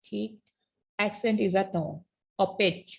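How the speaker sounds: ordinary delivery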